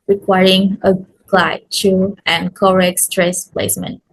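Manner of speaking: ordinary delivery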